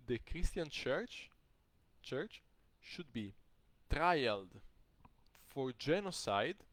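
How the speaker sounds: very slow, emphatic, with pauses; weighty pronouncement. Retold or imitated. Older man at lunch accusing the church, slow and portentous. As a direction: drop each phrase slowly for effect. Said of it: "he's saying this, get ready".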